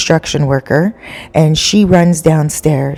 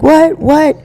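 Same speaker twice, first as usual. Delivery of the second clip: jolted awake and baffled, voice shooting up; startled confusion; 12 semitones higher than usual. Retold or imitated. Husband woken by his wife's scream, startled and confused.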